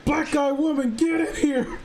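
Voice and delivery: high, laughing yell calling someone in; mock summoning yell dissolving into laughter; comic yelled summons. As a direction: bellow it out, cracking up.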